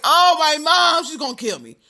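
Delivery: put-on a scared teen panicking about her mother; panicked wail. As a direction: wail in dread of your mom.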